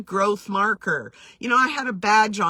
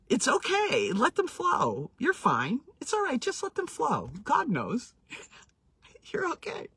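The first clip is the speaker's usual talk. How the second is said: tender comfort; 4 semitones higher, 4 dB quieter than usual. Retold or imitated. Comforting a crying young friend.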